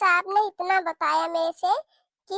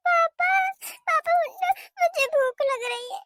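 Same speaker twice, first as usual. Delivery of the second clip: put-on high, pleading child calling papa; a hungry small child tugging at her father; children wake their sleeping father, high and pleading; little child voice; 9 semitones higher than usual.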